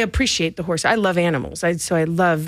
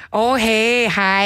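bright, higher Minnesota "oh hey"; Minnesota accent; 4 semitones higher, much slower than usual. Retold or imitated. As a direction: mimic a Midwestern Minnesota greeting. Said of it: "now she has a".

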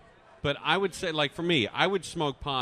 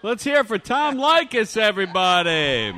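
high, loud announcer shout; applause call; 8 semitones higher, 9 dB louder than usual. Pretend. Host asking crowd to cheer Tom Leykis, loud hype. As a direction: MC holler, stretched at the end.

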